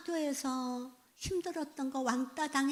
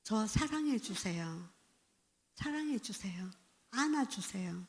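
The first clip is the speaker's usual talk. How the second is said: put-on child pleading for love; 4 semitones lower, 6 dB quieter than usual. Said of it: "이게 나와요".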